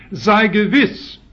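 "sei gewiss" drawn out, loud and high; second, stronger emphasis. Shown as a demonstration. Preacher quoting God's assurance to the believer, "sei gewiss" heavily emphatic. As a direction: hit "sei gewiss" hard and slow, as a firm assurance. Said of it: "eine zweite Betonung".

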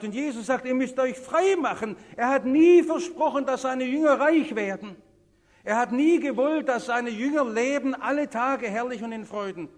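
high, loud preaching, driven home; full-force preaching. Real, not acted. Preacher warning the congregation about money's pull, full-force preaching.

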